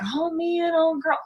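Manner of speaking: pitying. Retold or imitated. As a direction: play a friend feeling sorry for her. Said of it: "don't even be like".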